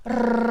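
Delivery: loud, long trilled Rrrr; rolled r demo. Shown as a demonstration. Teacher models a rolled Rrrr to repeat. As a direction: trill the Rrrr firmly for learners. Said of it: "Please repeat after me".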